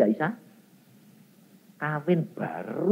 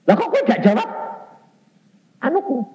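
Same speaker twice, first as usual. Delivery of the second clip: high, fast indignant scolding, much higher pitch; angry father; 14 semitones higher, 6 dB louder, faster than usual. Retold or imitated. Preacher voices his father scolding him for not listening, high and angry. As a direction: snap at him like an offended father.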